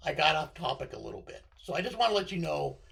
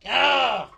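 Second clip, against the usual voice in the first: a sudden loud frustrated cry of "Ah!"; frustrated shout; 6 semitones higher, 11 dB louder than usual. Real, not acted. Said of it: "I'm dying".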